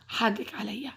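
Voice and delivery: soft unvoiced whisper; whispered apology. Son quietly apologizes to his hurt cousin in a whisper. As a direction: breathe the apology tenderly.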